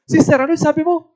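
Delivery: startled scolding, played for the story; acted startled reaction. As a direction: retell being taken aback by what was said. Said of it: "Kaya nagulat talaga ako".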